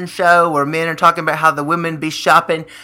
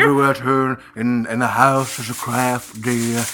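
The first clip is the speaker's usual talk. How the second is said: low, softer put-on husband voice; complaining husband; 5 semitones lower, 5 dB quieter than usual. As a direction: grumble to dear, low and subdued. Plays weary husband in a bit.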